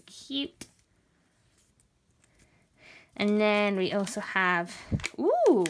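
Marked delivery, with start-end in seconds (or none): 5.2-5.6 high loud delighted ooh. Real, not acted. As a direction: let out a high surprised ooh. Seeing fancy pouch.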